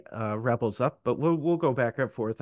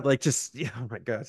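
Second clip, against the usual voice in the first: normal talking voice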